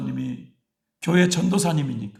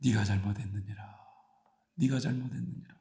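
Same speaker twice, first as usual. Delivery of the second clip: low, soft and solemn pronouncement; lightly voiced divine rebuke; voice of God; 4 semitones lower, 9 dB quieter than usual. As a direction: intone it gently as God correcting her. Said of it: "하나님은".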